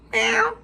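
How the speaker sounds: high mock cat meow; playful meowing at the cat. Cat noise after witch line.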